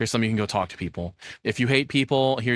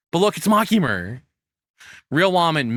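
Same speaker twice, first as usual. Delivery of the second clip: louder and higher delighted; gushing; 7 semitones higher, 5 dB louder than usual. Real, not acted. Streamer gushing at the anime image. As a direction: point at her with glee.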